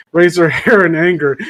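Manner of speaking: ordinary delivery, nothing marked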